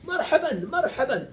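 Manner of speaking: high, loud, laughing welcome; hearty welcome. Retold or imitated. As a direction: beam out Marhaban like a glad host. Ils disent une formule.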